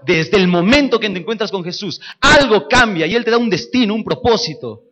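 loud, high, rousing sermon; preaching. Acted. Preacher rousing his church, loud and high. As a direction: preach it out to the congregation.

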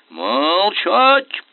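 high, loud bark; barked order. A narrator's reading. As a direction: slam him silent. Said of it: "гаркнул". (рявкнуть приказ)

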